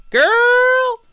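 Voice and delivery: put-on very high, loud American Girl. Shown as a demonstration. Showing how Americans say it, very high and loud. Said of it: "when Americans say it, it's like".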